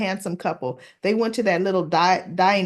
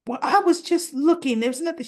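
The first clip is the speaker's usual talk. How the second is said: high-pitched mock protest; defensive excuse; 8 semitones higher than usual. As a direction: play a mother weakly explaining herself away. Mother defends herself to daughter.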